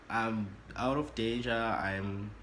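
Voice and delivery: plain reading voice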